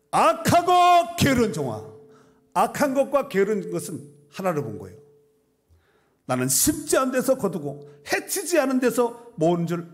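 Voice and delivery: loud, high scripture proclamation; full-force preaching. Real, not acted. Preacher quoting the master rebuking his lazy servant, full-force preaching. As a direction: thunder out the verse from the pulpit.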